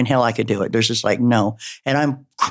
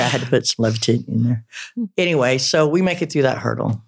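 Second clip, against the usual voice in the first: ordinary talk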